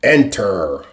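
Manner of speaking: low, soulful baritone voice; rhythm and blues baritone. A narrator's reading. Cadet answering a knock at the door, R&B baritone. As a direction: drop down into an R&B baritone. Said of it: "in his rhythm and blues baritone".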